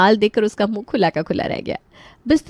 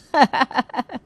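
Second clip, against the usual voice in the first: short high chuckle; high, light burst of laughter; bantering laugh; 6 semitones higher than usual. Acted. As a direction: laugh in character after the joke. Character laughs at own banter.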